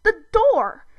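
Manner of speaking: high, loud exasperated yell; frustrated outburst; impatient shout. A narrator's reading. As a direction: yell it at the dwarf. Zim impatiently demanding the door.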